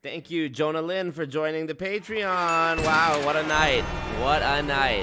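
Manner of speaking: loud, high, hyped thank-you; excited. Real, not acted. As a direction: shout out the supporter with big energy. Streamer thanking a Patreon joiner.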